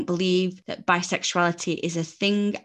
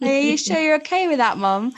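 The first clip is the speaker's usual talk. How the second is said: higher, tentative checking; anxious question; 8 semitones higher, 5 dB louder than usual. Retold or imitated. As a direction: ask carefully.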